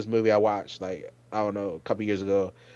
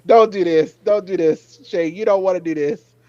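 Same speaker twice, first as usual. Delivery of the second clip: higher, louder pleading; pleading with Shane; 5 semitones higher, 6 dB louder than usual. Retold or imitated. Pleading with Shane on screen not to do it, high pleading. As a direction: beg him not to do it. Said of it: "I was like".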